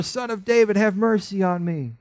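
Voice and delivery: high, loud desperate cry. A narrator's reading. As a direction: cry out even harder for mercy. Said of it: "he cried out all the more".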